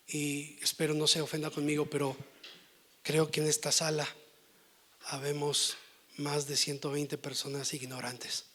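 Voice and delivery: ordinary talk, nothing marked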